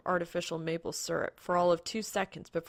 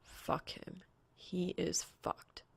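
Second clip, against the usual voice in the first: whispered, slow inner panic; hushed dismay; 9 dB quieter, much slower than usual. A narrator's reading. Soul realizing he's doomed, in a slow whisper. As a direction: breathe it out under your breath.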